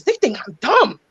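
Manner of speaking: lightly voiced resentful inner voice; mock resentful mom's inner voice; mock indignant inner voice. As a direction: grumble it as the offended inner voice. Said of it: "you in your voice be like".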